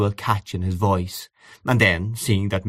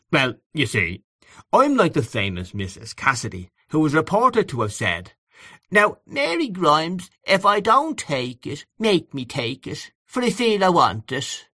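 put-on low Dublin accent; 7 semitones higher than usual. A narrator's reading. Mister Brown joking to three young ladies, mimicking in Dublin accent. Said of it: "assumed a very low Dublin accent".

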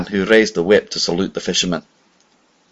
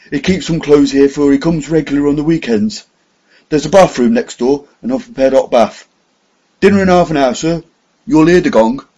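higher, louder, deferential servant; grave butler voice; 6 semitones higher, 5 dB louder than usual. A narrator's reading. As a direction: speak as a proper old butler.

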